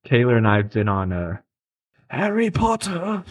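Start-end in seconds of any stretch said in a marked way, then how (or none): 2.1-3.2 high, slow mock-British call; exaggerated high British accent; put-on British accent. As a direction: draw it out in a hammed-up British accent. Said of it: "I do it in an accent".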